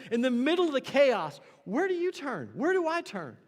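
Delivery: loud, high, driving sermon climax; building to a near shout; full-force preaching. Acted. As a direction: preach it out over the chaos.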